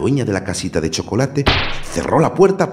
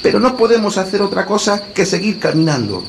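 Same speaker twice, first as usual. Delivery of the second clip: put-on boy voice=76; 13 semitones higher, 4 dB louder than usual. A narrator's reading. Hansel urges on.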